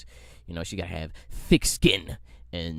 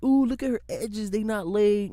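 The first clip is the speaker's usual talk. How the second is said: mock gossip voice; 12 semitones higher, 4 dB louder than usual.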